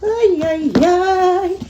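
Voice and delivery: high excited little chant; excited cheer. Real, not acted. Cheering over her bag.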